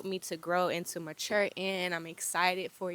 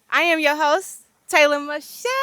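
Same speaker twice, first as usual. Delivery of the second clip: louder, punchier retake of the intro; proud host intro; 7 semitones higher, 11 dB louder than usual. Shown as a demonstration. Host retaking her intro line loud and proud. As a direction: own the intro this time. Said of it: "you trying to take my shine".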